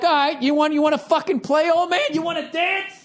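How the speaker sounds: put-on high, taunting dare to an old man; mock-tough high taunt; mock challenge. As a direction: goad him like a schoolyard challenge. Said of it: "and I'm like".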